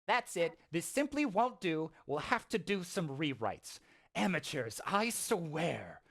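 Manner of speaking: mildly squeaky, fussy creature. Acted. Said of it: "And the voice goes".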